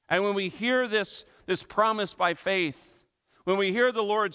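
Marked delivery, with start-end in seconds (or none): none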